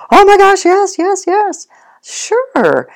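very high, loud gushing dog; excited dog voice. Retold or imitated. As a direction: play the dog thrilled to get the toy.

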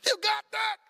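fired-up faith; excited confidence. Pretend. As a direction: tell God he has this, pumped up. Voicing carefree faith to God.

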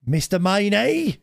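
high, drawn-out calling of a name; imitated shouting. Delivery man hailing a customer at the doorbell, mock shout. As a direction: call it out slowly, high-pitched, mimicking him. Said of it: "he shouts down the".

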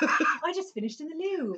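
put-on high, slow English accent; drawn-out English accent with a laugh. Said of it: "how's that sound?".

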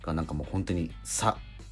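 ordinary delivery

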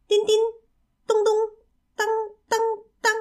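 high, separated imitation banjo notes; voiced banjo plinks. A narrator's reading. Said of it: "热情地弹着小斑鸠琴". (高声模仿斑鸠琴声)

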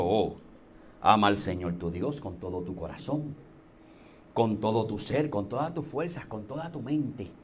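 very low, softened voice of the law teacher; put-on husky voice. Law teacher reciting the commandment to Jesus, in a put-on deep husky voice. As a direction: drop far down into a solemn, hushed recitation. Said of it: "el hombre puso la voz jonca".

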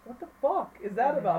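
loud, high incredulous question; baffled outburst. Retold or imitated. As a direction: retell it as indignant bewilderment. Retold baffled reaction.

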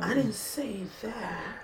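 slow hushed aside; whisper. Real, not acted. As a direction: whisper it so no one hears. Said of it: "whisper aside".

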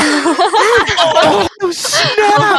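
high excited "Oh, snap!". Real, not acted. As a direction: cheer the burn, pitch way up. Reacting to a good comeback.

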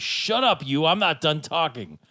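loud, high mock yell; Bender. Host quoting Bender yelling at Zoidberg, mock angry yell. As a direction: cut someone off with a cartoonish shout. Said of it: "where Bender yells at Zoidberg".

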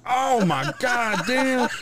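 high, drawn-out slow outcry with a laugh in it; mock-pained exclamation, stretched long and laughing; retold pained outcry. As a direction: yelp it like it really stung. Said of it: "I was like".

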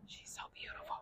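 whispered admiration; awed whisper. Real, not acted. Admiring the cow up close, awed whisper. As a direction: breathe it out in awe.

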